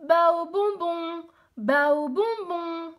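cri de marchande. Acted. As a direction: hawk the sweets, drawn out. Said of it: "je criais". (crier sa marchandise au marché)

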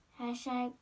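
ordinary delivery, nothing marked

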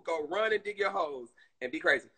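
ordinary talk

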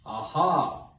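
thunderous storybook "aha"; thundering god. A narrator's reading. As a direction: boom it out like a god. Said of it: "thundered the voice of Guy".

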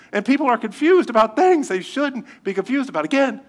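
high-pitched, emphatic sermon delivery; forceful preaching. Real, not acted. Preacher warning the congregation against confusion, forceful preaching. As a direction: drive each point home to the congregation.

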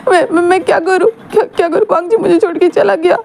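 high, sobbing and helpless; crying. Acted. Wing asking his friend what to do, crying high. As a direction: beg through tears, high-pitched. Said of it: "और wing just रोने लगता है".

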